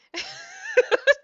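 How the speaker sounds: high, loud burst of laughter; real laugh. Real, not acted. Laughs at the creepy story.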